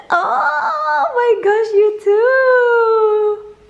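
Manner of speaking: very high, slow, squeaky gushing. Real, not acted. Mother squealing at her daughter. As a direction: squeal with delight.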